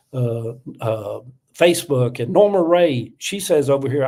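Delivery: usual speaking voice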